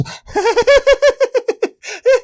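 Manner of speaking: high eerie cackle imitating the child; imitated possessed-child cackle; creepy cackle. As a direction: mimic a creepy little girl's cackle. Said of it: "really creepy cackling".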